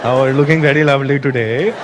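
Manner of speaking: put-on low, smooth pick-up voice with an Indian accent; flirting Indian man. Indian men hitting on chicks while holding pinkies, low and flirtatious. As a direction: play a man chatting up women, pitched low. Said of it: "still try and mac on chicks".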